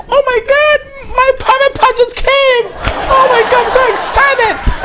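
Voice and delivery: very high, slow, squealing falsetto of delight; squealing bride. Retold or imitated. Bride to the wedding guests, a high thrilled falsetto squeal. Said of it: "And she's like".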